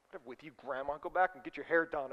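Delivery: low, soft acted grumble; dismissive muttering. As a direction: brush someone off under the breath. Acted dismissive mutter while passing grandma.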